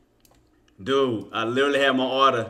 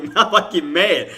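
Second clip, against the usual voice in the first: ordinary delivery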